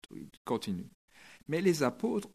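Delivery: ordinary delivery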